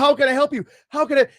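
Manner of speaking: high, loud, frantic pleading, imitating wife; freaking-out wife. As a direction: act out a wife panicking to help. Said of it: "freaking out".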